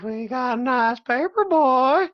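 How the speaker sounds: a goofy exaggerated country-accent voice; teasing exaggerated accent; mock alternative accent. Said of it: "Would you rather me do it like".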